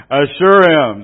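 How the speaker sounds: put-on punchline shouted as the old drunk; hammed-up drunk, loud. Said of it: "I was gonna do the drunk voice".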